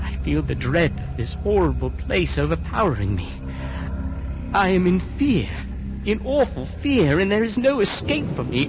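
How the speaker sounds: terrified. Acted. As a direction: voice climbing into dread. Harker in terror at the window.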